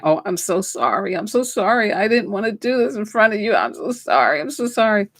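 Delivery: high, tearful sorry, voice breaking; apologising to a dying mother through tears; reliving a crying apology in a high voice. Retold or imitated. I'd be crying down the aisles.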